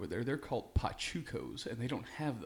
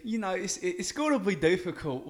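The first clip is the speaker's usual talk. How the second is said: lightly done cockney bloke, mate-style; put-on British accent; 3 semitones higher, 7 dB louder than usual. Guest on the show, answering in a light mock British accent. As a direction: play a chatty Brit. S0 plays British character Welvin.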